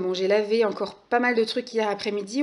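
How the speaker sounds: ordinary delivery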